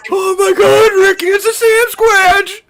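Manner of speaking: high screaming cry; mock panicked shout. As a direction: shriek it like you've spotted a monster. Mock alarm at Sasquatch.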